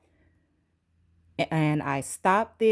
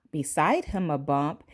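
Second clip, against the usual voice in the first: ordinary delivery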